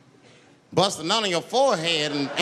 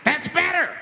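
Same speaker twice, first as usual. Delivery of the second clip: high, raised-voice approval; high mock outburst; 11 semitones higher, 4 dB louder than usual. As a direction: call it out high and forceful. Gunless tyrant approves the quiet.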